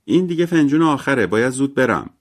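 high, loud call; calling out loudly. A narrator's reading. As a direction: raise your voice to carry into the next room. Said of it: "مری جین بلند گفت". (با صدای بلند و زیر)

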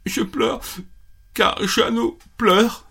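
a small fox whimpering out his reason; high sad fox voice. Acted. Fox explaining to the rabbit, high and mildly tearful. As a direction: pitch up high, a touch of tears, slow.

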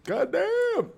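high squeaky shocked "God damn"; shocked exclamation. Retold or imitated. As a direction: squeal it in disbelief. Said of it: "and I'm like".

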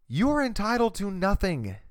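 ordinary talk, nothing marked

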